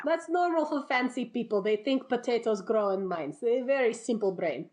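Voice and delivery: put-on villager in a comic Russian accent. Villagers explaining away the vanished Romanovs, Russian accent. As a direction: put on a Russian accent for simple-minded peasants. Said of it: "anybody listening with an actual Russian accent".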